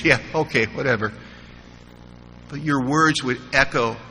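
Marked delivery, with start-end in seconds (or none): 0.0-1.1 low, softer brush-off; dismissive tough guy. Retold or imitated. Newcomer brushing off kind words, dismissive. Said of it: "tough guy, dismissive attitude".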